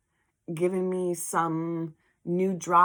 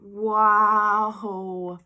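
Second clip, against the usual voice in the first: wow; 3 semitones higher, 8 dB louder than usual. Retold or imitated. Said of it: "And I just went".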